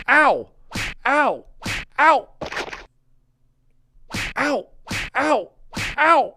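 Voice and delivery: high, loud "ow" yelp; mock pain yelp. Skit character taking repeated hits, mock pain yelps. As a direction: yelp sharply as if struck. Yelps along with hit effects.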